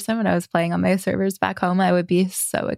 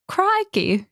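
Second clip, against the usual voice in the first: put-on high-pitched caricature Aussie accent; mock Australian accent; 11 semitones higher than usual. Asking about an Apex character's accent, mock Australian. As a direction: exclaim it in hammed-up Australian. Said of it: "Is it very, like".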